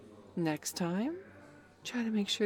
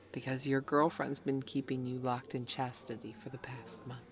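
ordinary talk

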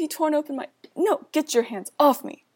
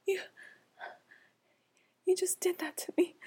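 high broken whisper; 7 semitones higher, 5 dB quieter than usual. Acted. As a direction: whisper it, shattered and pleading. Broken aftermath, begging.

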